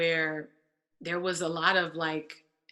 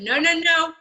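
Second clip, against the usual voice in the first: insistent denial; 9 semitones higher, 8 dB louder than usual. Retold or imitated. Retold firm protest.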